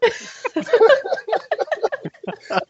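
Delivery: real high, long laugh. Real, not acted. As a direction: laugh freely at your own confusion. Laughs at missing coach's words.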